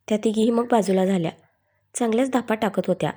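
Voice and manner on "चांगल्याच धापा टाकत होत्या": ordinary delivery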